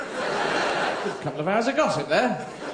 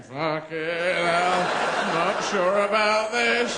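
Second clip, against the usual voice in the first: unsure cat; 3 semitones higher, much slower than usual. Acted. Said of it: "You never see a cat going".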